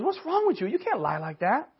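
mock scolding.

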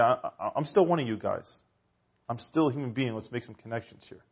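soft, gentle reassurance; tender, quiet "still one of you"; peaceful. Retold or imitated. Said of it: "in a peaceful way".

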